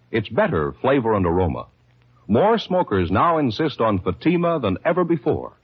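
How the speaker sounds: ordinary delivery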